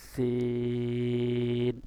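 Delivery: long held vowel, three alif stretch; drawn-out sin. Shown as a demonstration. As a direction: hold the vowel long and loud like a Quran teacher. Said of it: "তিন আলিফ টান দিবেন".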